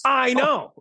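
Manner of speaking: high, drawn-out agreement; retold exasperated agreement. Director agreeing with the star about trailer luxuries, exasperated.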